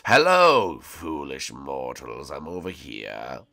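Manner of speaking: low, slow menacing voice; dark lord. Acted. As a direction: loom over them like a dark lord. Villain greets crowd.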